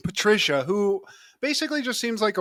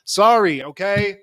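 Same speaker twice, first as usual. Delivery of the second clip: mock sarcastic apology; 2 semitones higher, 8 dB louder, slower than usual. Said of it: "it's a lot of like".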